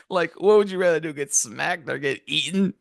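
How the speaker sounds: ordinary talk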